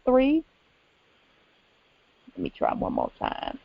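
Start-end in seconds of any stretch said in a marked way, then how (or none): 2.4-3.6 whispered under her breath to herself; whispered aside as the call fails again. Real, not acted. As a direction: drop to a whisper while fiddling with the line. Aside while retrying the call line.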